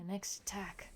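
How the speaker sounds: soft, ominous whisper; whispered echo of an ominous warning; dramatic whisper. Acted. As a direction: breathe the threat out in a whisper.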